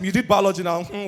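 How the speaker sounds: ordinary talk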